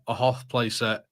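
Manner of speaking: ordinary delivery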